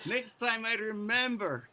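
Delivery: high, hammed-up Russian accent, mocking apology; sarcastic Russian accent for the guards. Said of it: "doing the Russian accent thing".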